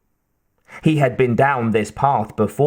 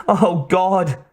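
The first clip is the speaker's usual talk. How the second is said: despairing, pitched-up cry; weak cry; 7 semitones higher than usual. A narrator's reading. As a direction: cry out with little strength left. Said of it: "Kate cried weakly".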